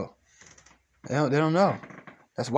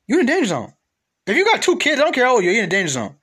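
shouting, high and loud; loud warning; 8 semitones higher, 5 dB louder, faster than usual. Real, not acted. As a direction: yell it as a warning.

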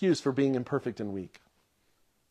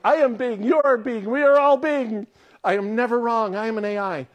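mock-grand, higher and louder AI proclamation; grandiose AI voice; 6 semitones higher, 4 dB louder than usual. Mocking the AI's boasts to his congregation, grandly. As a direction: declare it like an all-powerful machine. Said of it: "when he was like".

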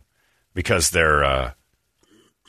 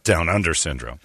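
usual speaking voice

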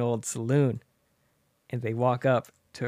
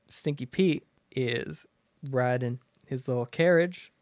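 usual speaking voice

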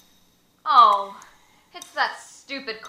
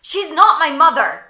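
indignant outburst; 5 semitones higher, 17 dB louder than usual. Acted. Boy protests loudly.

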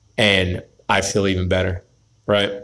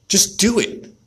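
high urgent push; 9 semitones higher than usual. Real, not acted. Urging himself on, high.

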